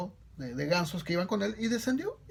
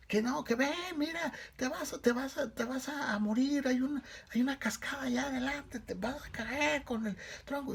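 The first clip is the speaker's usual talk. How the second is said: urgent warning calls; 9 semitones higher than usual. A narrator's reading. Companions warn goose of the waterfall, high urgent calls. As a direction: urge him to fly before the falls. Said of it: "le empezaron a gritar desde arriba".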